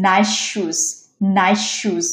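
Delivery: নাইস said "naish", merging into শুজ; one long sh across নাইস শুজ; assimilated S. Shown as a demonstration. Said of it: "এটা হচ্ছে হবে".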